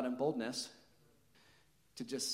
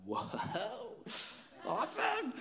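drawn-out whoa leaping to a very high "awesome"; awestruck delight; 10 semitones higher, much slower than usual. Retold or imitated. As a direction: re-enact wide-open amazement, pitch shooting up. Acting own amazed reaction to healing.

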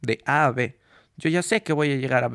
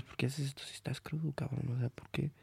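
whispering; 12 dB quieter than usual. Retold or imitated. Imagined listener questions him.

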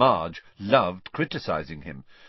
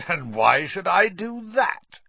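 higher, louder, slow mocking sneer; 10 semitones higher, 5 dB louder, slower than usual. A narrator's reading. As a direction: drag it out, mocking the boy. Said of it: "sneered Uncle Vernon".

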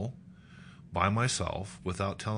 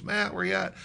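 high-pitched wife calling for her husband; 12 semitones higher than usual. Acted. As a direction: call out searchingly, voice pitched well up.

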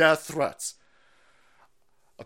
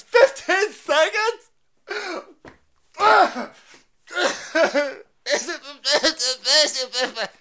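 high, loud, slow outraged disbelief; mock outrage; 14 semitones higher, 9 dB louder, much slower than usual. Mocking a lefty outraged at waiting, shrill and slow. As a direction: shriek it in disbelief.

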